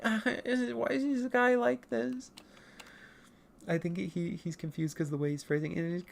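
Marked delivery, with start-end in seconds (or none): 0.0-2.3 Penta. Acted. Confused by the other's odd phrasing, baffled high voice. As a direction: ask it baffled, pitched well up. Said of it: "And then Penta's like".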